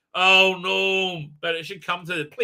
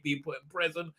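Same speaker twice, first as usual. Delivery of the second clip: ordinary delivery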